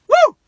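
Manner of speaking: short, loud "woo" cheer; celebratory high whoop; excited whoop. Real, not acted. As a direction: let out a delighted whoop. Cheering own giant-pie line.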